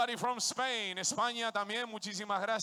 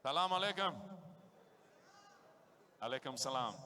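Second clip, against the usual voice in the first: ordinary talk, nothing marked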